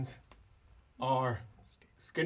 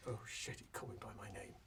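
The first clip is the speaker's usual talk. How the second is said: hushed, alarmed aside; very soft, almost whispered dread; Felix; 5 semitones lower, 16 dB quieter, much faster than usual. Acted. Felix realising he was called by name, hushed aside. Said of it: "as Felix goes".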